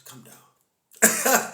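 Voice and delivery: laughing through a teasing come down. Pretend. Teasing a know-it-all while cracking up, laughing. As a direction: wheeze the words out mid-laugh.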